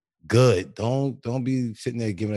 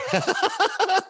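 laughing. Real, not acted.

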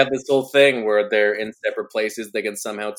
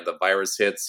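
ordinary delivery

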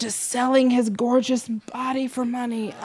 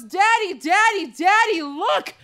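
put-on higher, excited little girl calling Daddy; imitating an excited little girl; 6 semitones higher, slower than usual. Child telling her dad, in a higher excited voice. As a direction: mimic a small child tugging at her dad. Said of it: "she turns to her dad, and she goes".

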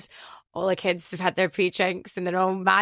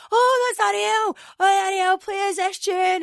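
high, slow, starstruck fan squeal; mimicking Glasgow fans recognising her; excited fan voices; 13 semitones higher, 4 dB louder, slower than usual. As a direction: call out like a thrilled kid spotting the DJ. Voicing excited fans in crowd.

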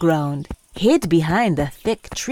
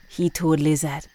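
usual speaking voice

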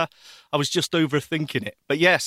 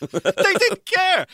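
exaggerated; 14 semitones higher, 4 dB louder, much slower than usual. Real, not acted. No one cared at passport office.

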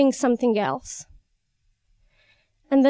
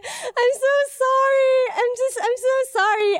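hammed-up wailing apology; tearful apology; 13 semitones higher than usual. Injured woman sobbing sorry onto the driver's shoes, mock tearful. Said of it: "while I'm crying".

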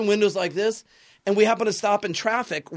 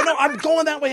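panicked protest; 10 semitones higher, 7 dB louder than usual. Retold or imitated.

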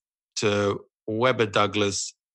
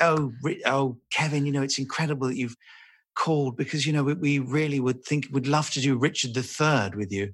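imitated eager producer, pitched higher; gushing producer; 4 semitones higher than usual. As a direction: flatter a star in a higher voice. Acts out the gushing BBC producer.

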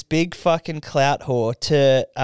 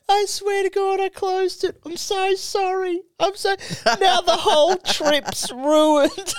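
very high, whiny fake sobbing voice; hammed-up tearful girlfriend pleading; mock crying. Girlfriend begging boyfriend's forgiveness, in a hammed-up weepy falsetto. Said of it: "she just starts crying".

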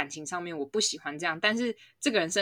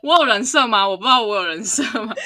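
laughing; 4 semitones higher, 11 dB louder than usual. Real, not acted. Teasing about their persona.

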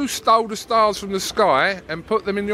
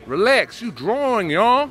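mock Philly accent, rising higher; Philly accent attempt; 4 semitones higher, slower than usual. Shown as a demonstration. Copying the fighter's slang, attempted Philly accent. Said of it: "I should do some of your Philly accent".

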